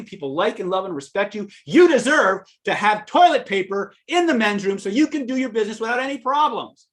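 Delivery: out-yell the angry customer on his side; matching his anger. Retold or imitated. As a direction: clerk matching the bodybuilder's rage at the missing paper. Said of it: "his training kicked in".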